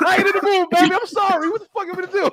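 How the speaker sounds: high, softened mock-pleading voice; mock whiny refusal. Man play-acting turning down his baby, high mock apology. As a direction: put on a high, pleading little voice.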